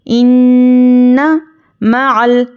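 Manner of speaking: इन्ना with a long nasal hum on the doubled न, very slow; recitation with ghunna. Shown as a demonstration. Teacher shows students the ghunna, slow nasal recitation. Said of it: "गुन्ना होगा".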